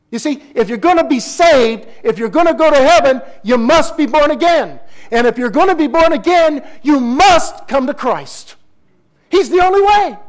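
high, loud and urgent throughout; preaching at full force. Real, not acted. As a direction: build the altar call with rising fire.

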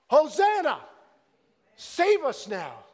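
loud, high cry, then slowed plea; yelling. Shown as a demonstration. Pastor showing the congregation how to yell Hosanna. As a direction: cry it out with all your heart. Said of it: "we should be yelling".

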